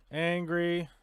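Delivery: ordinary delivery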